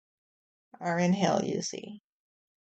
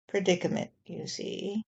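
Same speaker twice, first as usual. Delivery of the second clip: ordinary delivery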